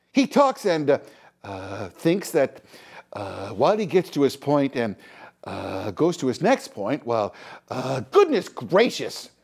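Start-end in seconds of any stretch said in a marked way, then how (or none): 0.9-8.0 Justin Trudeau. Retold or imitated. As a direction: drag each phrase out between hesitations. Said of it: "when Trudeau speaks, with almost every second word".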